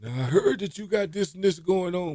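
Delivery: high-pitched impression of a godfather-like coach; Coach Casson. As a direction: summon a player in a put-on, high voice. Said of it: "you meet the Godfather".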